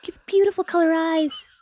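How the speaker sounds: slow, high-pitched peanut charm; creepy little peanut voice. Retold or imitated. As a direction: coo it like a sly little flatterer. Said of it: "Your little creepy voice is ridiculous".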